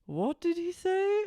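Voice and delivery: high, slow, mock-puzzled question; imitated baffled question. Lisa asking Andy about the joke, mimicked as high bafflement. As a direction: lift it right up as acted bafflement.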